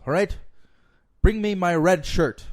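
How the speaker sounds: loud, high acted order; captain's command. Captain orders his crew before battle, loud command. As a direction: call out the order like a ship's captain.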